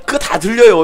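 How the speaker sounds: ordinary talk, nothing marked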